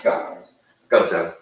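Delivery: loud fast call to the dogs. Real, not acted. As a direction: shout the dogs out the door. Sending the dogs out.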